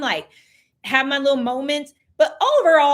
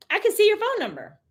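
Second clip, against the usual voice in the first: higher, faster, eager; quick, pitched-up reveal; excited reveal; 8 semitones higher, faster than usual. Real, not acted. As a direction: announce the discovery with a lift.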